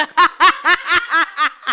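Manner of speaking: loud, high peals of laughter; real laughing fit. Real, not acted. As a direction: crack up helplessly. Laughing at retold voice note.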